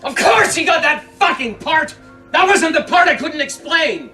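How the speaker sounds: high, angry shout; frustrated yelling. Acted. Man snapping at the woman who tried to explain, yelling. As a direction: explode in exasperation. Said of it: "you don't have to yell at me".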